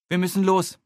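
hurrying off the line; calling into the phone. A narrator's reading. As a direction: Nele calls into the phone. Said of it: "ruft Nele ins Telefon".

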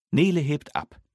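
ordinary delivery

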